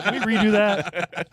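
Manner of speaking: laughing while asking. Real, not acted. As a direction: giggle through the question. Laughing, asks to retry joke.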